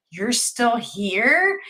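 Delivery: slow, stretched question with a rising end; startled disbelief. Retold or imitated. Nurse staring at a forgotten patient, astonished. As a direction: repeat it as if unable to believe it. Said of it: "looks at me like she's seen a ghost".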